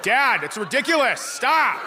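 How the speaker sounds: imitated embarrassed teenager, high and drawn-out; exasperated teenage daughter. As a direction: whine at dad to stop, pitch swooping up. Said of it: "She was like".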